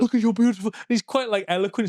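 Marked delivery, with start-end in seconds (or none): 0.0-0.7 a hint of a swooning admirer, a little higher; lightly voiced gushing fan; adoring stalker. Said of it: "He's like".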